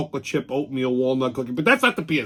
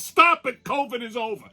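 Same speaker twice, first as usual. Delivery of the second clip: high, exasperated quote; high exasperated mock quote; mock announcement; 7 semitones higher than usual. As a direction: spit out the obvious. Quoting WHO mockingly.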